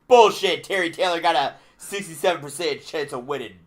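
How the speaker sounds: mock outburst. Co-host spoofing match odds, loud mock outburst. Bursts out mid computer-prediction bit.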